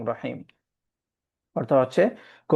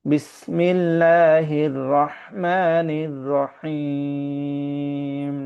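slow, devout drawn-out chant; heartfelt recitation; much slower than usual. Shown as a demonstration. Teacher reciting names of God with feeling, slow devout chant. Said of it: "এরকম অনুভব করে".

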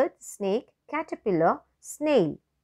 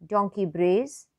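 ordinary delivery

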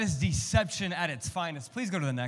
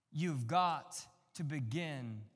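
ordinary delivery

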